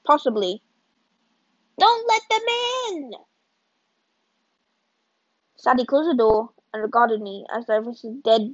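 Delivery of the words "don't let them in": high, urgent cry to keep them out; frantic plea. Acted. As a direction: beg them not to let anyone in, pitched high.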